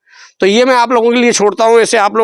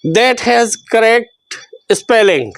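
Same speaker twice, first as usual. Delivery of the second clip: slow read-out; much slower than usual. Shown as a demonstration. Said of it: "ये कह रहा है".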